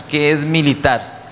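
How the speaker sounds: normal talking voice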